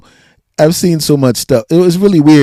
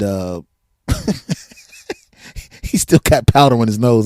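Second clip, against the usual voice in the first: ordinary talk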